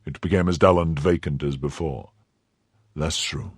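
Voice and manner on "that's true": softer, low and subdued; quietly. Acted. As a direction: say it quietly, looking inward. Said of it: "said he quietly".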